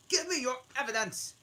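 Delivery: high, loud and fast demand; angry king. Acted. King angrily pressing the Hatter for evidence. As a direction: snap it at the witness. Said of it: "said the king repeatedly, angrily".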